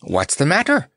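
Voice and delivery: high, puzzled clown question; Notta. A narrator's reading. Clown asking the lion what is wrong, high-voiced. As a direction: ask it lightly, pitched well up. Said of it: "asked Notta".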